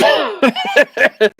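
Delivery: high, loud burst of laughter; sudden loud cackling laugh; real laugh. Real, not acted. Reacting to co-host's fear of falling.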